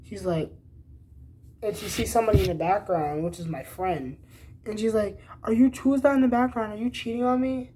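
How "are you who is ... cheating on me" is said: put-on quick, high, louder jealous girlfriend voice; imitating a suspicious girlfriend, pitched up; accusing girlfriend. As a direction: fire off the jealous questions fast and high. Girlfriend accusing him on FaceTime.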